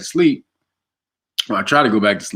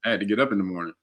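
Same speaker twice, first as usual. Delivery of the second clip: ordinary talk, nothing marked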